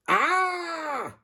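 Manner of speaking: sudden high-pitched yelp of fright; a quick frightened squeal; high scream. A narrator's reading. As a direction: shriek in surprise. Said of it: "screamed Harold".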